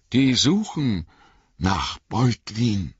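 ordinary delivery, nothing marked

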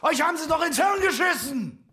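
very high outraged yell; high-pitched angry outburst; furious shout. Acted. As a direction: shout the insult in sheer exasperation. Insult shouted in quarrel.

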